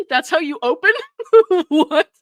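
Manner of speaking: loud, high disbelief breaking into laughter; incredulous laughing. Real, not acted. Podcaster reacting to his ridiculous opener, incredulous laughing. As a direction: laugh out the question, baffled.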